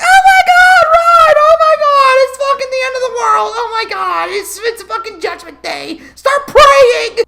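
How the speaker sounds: panicked screaming. Retold or imitated. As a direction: scream like the world is ending, hammed up. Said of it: "everyone's like".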